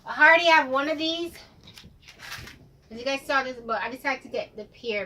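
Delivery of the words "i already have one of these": loud, excited outburst; excited exclamation. Real, not acted. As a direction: blurt it out, suddenly loud. Excited exclamation on finding duplicate.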